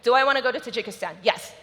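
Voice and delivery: rapid gut-check question and snap answer; quick yes-or-no self-question; really fast. Shown as a demonstration. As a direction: fire it off without thinking. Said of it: "really fast".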